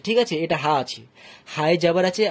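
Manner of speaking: ordinary talk, nothing marked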